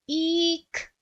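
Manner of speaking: a sudden high-pitched eek; high squeal. A narrator's reading. Someone hidden in the bushes cries out, high squeal. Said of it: "someone cried".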